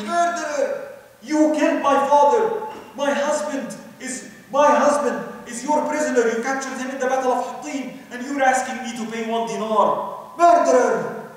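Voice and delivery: a grieving woman cursing the sultan; shouting, cursing. Retold or imitated. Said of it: "shouting at him, cursing him".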